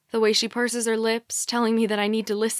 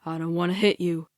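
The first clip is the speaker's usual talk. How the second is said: low-pitched, held-back voice; conflicted captor; 4 semitones lower than usual. A narrator's reading. Captor who is afraid to hurt her, low voice. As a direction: speak deep and restrained.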